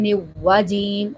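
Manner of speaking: "نواجیم" with a doubled w where the r belongs; waw replacing Ra. Shown as a demonstration. Teacher shows a common recitation mistake, w-sound "نواجیم". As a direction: drop the r and say w in "نواجیم". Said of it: "خود سے واو کا اضافہ کر دیتے ہیں".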